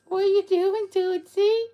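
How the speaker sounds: high cooing call to a pet. Real, not acted. Owner calling to her pet in a high pet voice.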